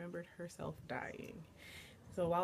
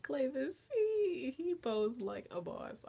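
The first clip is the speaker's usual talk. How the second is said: excited fangirl gush; 7 semitones higher, 5 dB louder, slower than usual. Real, not acted. Fan recalling how Clavis posed, thrilled. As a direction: pitch leaping up in delight. Gushing over character's pose.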